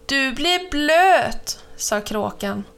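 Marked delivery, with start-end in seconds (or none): 0.1-1.6 drawn-out warning. A narrator's reading. Crow warns cow, stretched and loud.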